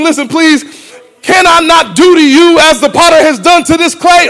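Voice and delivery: God calling Reggie to listen; God calling tenderly. Acted. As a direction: call him by name, pleading. God calling to congregant by name.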